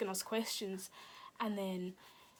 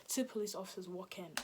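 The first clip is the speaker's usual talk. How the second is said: ordinary talk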